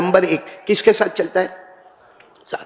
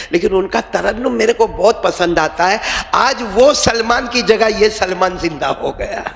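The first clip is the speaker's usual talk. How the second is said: ordinary talk, nothing marked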